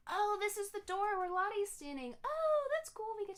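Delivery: high, loud delighted surprise; excited discovery. Real, not acted. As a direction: burst out high and loud at a happy find. Discovers the decoratable door.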